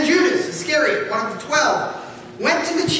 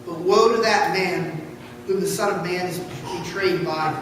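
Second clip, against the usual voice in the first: grave; 7 semitones lower than usual. Acted. Jesus pronounces woe.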